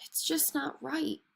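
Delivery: soft, slow grumble under the breath; mumbled to himself. A narrator's reading. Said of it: "mumbled to himself".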